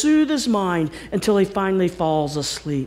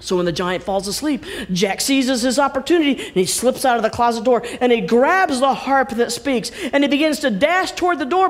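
ordinary delivery

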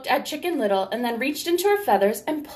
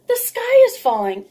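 high, loud squeak of alarm; a frightened little hen crying out; alarmed Chicken Little; 13 semitones higher, 7 dB louder than usual. Acted. Little hen tells the sky is falling, high squeaky alarm.

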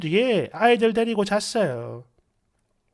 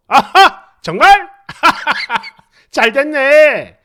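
husband cracking up at the news; laughing loudly; 3 semitones higher, 7 dB louder, slower than usual. Acted. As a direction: laugh big through the words. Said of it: "남편이 막 크게 웃으면서".

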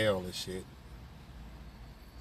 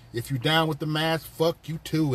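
normal talking voice

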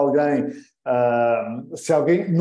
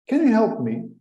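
lightly raised, asking voice; mild polite plea; polite request; 7 semitones higher than usual. Shown as a demonstration. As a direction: sound like someone who needs help.

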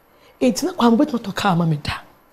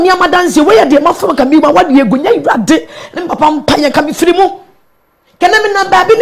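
higher and louder, building; worked-up retelling; 8 semitones higher, 8 dB louder than usual. Real, not acted. A pastor recounting her stubborn years to the host, worked up. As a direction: press every phrase out with rising heat.

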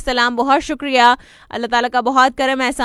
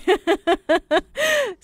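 real laugh; 8 semitones higher than usual. Real, not acted. Laughs about Pakistani public.

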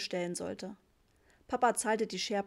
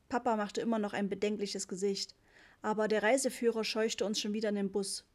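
usual speaking voice

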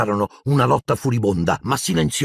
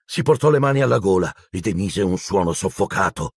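ordinary delivery, nothing marked

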